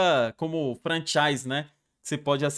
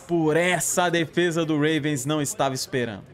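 ordinary delivery

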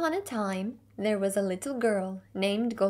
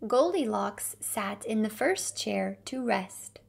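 ordinary delivery

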